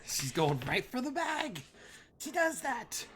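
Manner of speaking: very high-pitched exclamation; high amused squeal. Real, not acted. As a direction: squeal as the dog goes for the bag. Exclaiming as dog lunges for bag.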